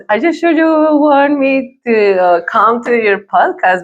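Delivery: ordinary talk, nothing marked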